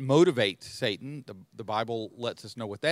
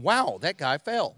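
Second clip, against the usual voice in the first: lightly voiced sneering Satan, a touch higher; mock Satan; 4 semitones higher, 4 dB louder than usual. Satan scoffing at a believer who fell, lightly mocking. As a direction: toss it off like an unimpressed devil.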